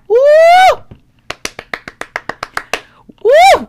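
high, loud joyful whoop; a real burst of glee. Real, not acted. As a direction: shout it out with real joy. Overjoyed at the scripture.

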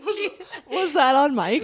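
embarrassed laugh in the words. Real, not acted. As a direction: laugh it out sheepishly.

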